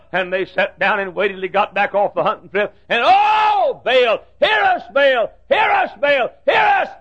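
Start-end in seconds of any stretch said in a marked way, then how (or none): 3.1-6.9 high shouted appeal to a false god; desperate prophets crying out; frantic pleading cry. Acted. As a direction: wail to Baal again and again.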